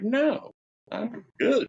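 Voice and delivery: high squeaky no thanks; mock high refusal. Turning down the basement in a high mock voice. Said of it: "I'm like".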